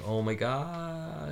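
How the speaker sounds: drawn-out, soft, high moan; imitated pain. Imitating the injured fighter in serious pain, moaning. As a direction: moan it slowly as if hurt. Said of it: "in serious pain".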